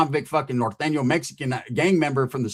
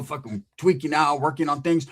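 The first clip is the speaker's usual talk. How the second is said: usual speaking voice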